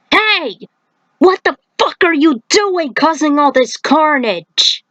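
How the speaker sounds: high, indignant call-out at a monster; angry yell. Acted. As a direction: yell at the beast in outrage. Johnny confronts rampaging beasts.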